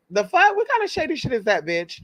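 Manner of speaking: scandalized and shocked; outraged disbelief. Real, not acted. Reacting to the mother sent to a care home, high outraged disbelief.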